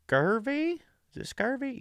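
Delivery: high, soft uncertain "scurvy"; unsure high question. Real, not acted. Unsure of word.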